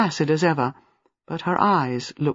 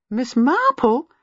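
delighted surprised call, higher and slow; lively surprise; 8 semitones higher, slower than usual. Acted. Gwenda spotting Miss Marple in the shelter, lively surprise. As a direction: hail an old friend unexpectedly. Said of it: "she exclaimed in lively surprise".

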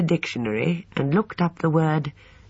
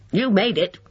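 a touch of accusation, higher and louder; accusing; 7 semitones higher, 4 dB louder than usual. Acted. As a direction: point the finger at her, mildly. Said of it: "he added accusingly".

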